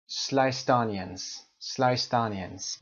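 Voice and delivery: sliced onions very slow and joined; sliced onions linked; slow linked demo. Shown as a demonstration. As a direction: stretch sliced onions as one word.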